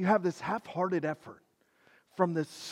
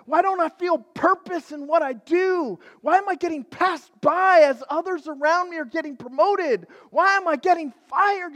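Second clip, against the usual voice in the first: rising, louder string of desperate questions; anguished future self; 8 semitones higher, 6 dB louder than usual. Retold or imitated. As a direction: build the panic question by question. Acting a lonely future self.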